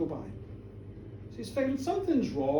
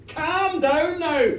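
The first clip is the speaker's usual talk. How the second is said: loud, higher, very drawn-out plea; firm calming order; 5 semitones higher, 7 dB louder, much slower than usual. A narrator's reading. Minister calming accusing village women, raised voice. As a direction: rein in the crowd slowly and loudly.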